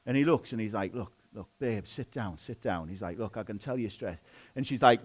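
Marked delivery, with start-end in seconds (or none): 0.9-2.9 hushed, low, placating voice; soothing husband. Retold or imitated. Husband calming his stressed wife on the couch, soothing and hushed. 3.2-4.2 soft, low reassurance; soothing husband. Retold or imitated. Husband to his wife just back from work, soothing.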